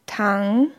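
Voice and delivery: Tang dipping low, then rising; third tone. Shown as a demonstration. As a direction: sink Tang down and bring it back up. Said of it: "sinkt die Tonhöhe". (Tang im dritten Ton, fallend-steigend)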